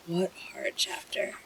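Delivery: whispered to oneself. Shown as a demonstration. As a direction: breathe it out, spent. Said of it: "Jeez, what a hard chapter".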